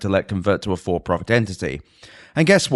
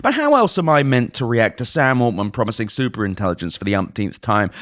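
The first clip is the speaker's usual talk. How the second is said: normal talking voice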